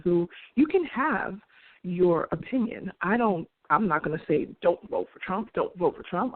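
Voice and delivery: ordinary talk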